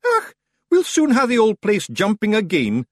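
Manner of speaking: high, loud cheeky Scots pixie; cocky and cheerful; grinning Feegle. Acted. Rob Anybody teases the ferryman, grinning.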